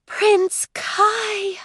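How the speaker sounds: high, dreamy robot sigh; sighing android voice. Acted. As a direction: swoon over him like a smitten robot. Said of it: "her voice taking on the unexpected tinge of a sigh".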